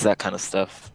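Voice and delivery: usual speaking voice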